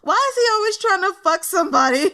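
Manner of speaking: high, loud words through laughter; laughing disbelief. Real, not acted. Vlogger cracking up over a crude character, laughing shout. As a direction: crack up mid-question.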